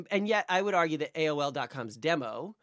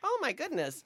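put-on very high, quavering old lady exclamation; old person voice; 12 semitones higher than usual. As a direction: gasp it like a sweet old lady. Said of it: "I'm talking like an old person".

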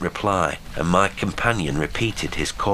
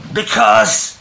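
high, loud word left hanging; stopping short; 9 dB louder than usual. A narrator's reading. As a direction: call one word, then cut off. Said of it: "and stopped short".

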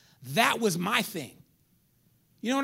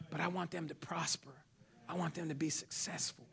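whispered, softer and lower; softer, tender whisper; 4 semitones lower, 11 dB quieter than usual. Real, not acted. Father speaking of his kids, in a soft whisper. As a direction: breathe it like a secret wish. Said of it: "tender aside".

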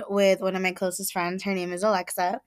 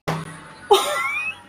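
shrieking laugh. Real, not acted. Loud burst of laughter.

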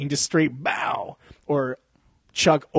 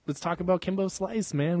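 normal talking voice

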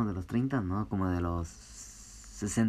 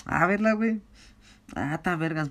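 high mock voice; 8 semitones higher than usual. Acting a friend asking for weed.

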